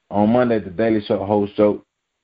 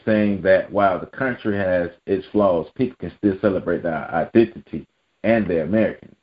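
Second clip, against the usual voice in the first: ordinary delivery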